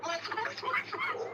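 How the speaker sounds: high squealing wasp, wasp; mock squeal. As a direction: squeal the word wasp, climbing each time. Mocking the wasp pronunciation.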